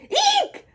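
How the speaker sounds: very high squeal; frightened eek. Acted. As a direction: squeal like a scared elephant. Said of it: "shouted the elephant".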